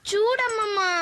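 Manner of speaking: put-on girl whining to her grandmother about unfair treatment, tearful; whiny complaint. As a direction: whimper the call.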